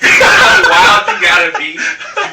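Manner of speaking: high, loud, laughing disbelief; loud, drawn-out comic incredulity; incredulous outburst. Real, not acted. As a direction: shout it slowly in amused outrage. Incredulous outburst at absurd story.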